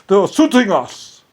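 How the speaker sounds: high, loud mock outrage; mocking complaint. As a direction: parody fake victimhood loudly. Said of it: "they tried to say".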